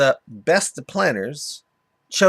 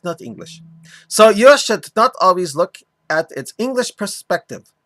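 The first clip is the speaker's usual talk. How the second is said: mock Arabic accent; 2 semitones higher than usual. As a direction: lecture it in a hammed-up Arabic accent. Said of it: "continue doing my mock Arabic accent for him".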